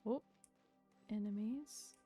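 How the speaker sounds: usual speaking voice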